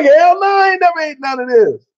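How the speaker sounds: high, loud half-laughing yell; laughing shout. Retold or imitated. Answering the Brit about breakfast, a high laughing shout. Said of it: "And I'm like".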